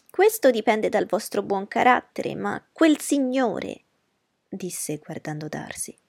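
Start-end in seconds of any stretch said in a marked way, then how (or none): 0.1-3.8 higher, louder fussy mother; fussy society mother, higher voice; Mrs Bennet's voice. Acted. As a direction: play the anxious mother.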